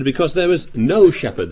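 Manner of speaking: "no" leaned on hard, then falling; stress on NO. Retold or imitated. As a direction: put the emphasis on the word "no". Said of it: "They put the emphasis on the word no".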